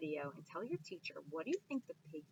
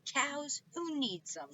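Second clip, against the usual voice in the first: grumbling witch; 9 semitones higher, 6 dB louder, slower than usual. Acted. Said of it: "Gritch muttered".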